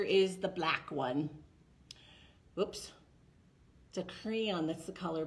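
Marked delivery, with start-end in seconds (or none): none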